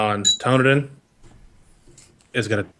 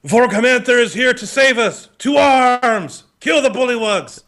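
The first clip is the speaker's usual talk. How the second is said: high, loud battle cry; rallying shout; 11 semitones higher, 4 dB louder than usual. Acted. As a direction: yell it to rally the troops. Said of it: "but he goes".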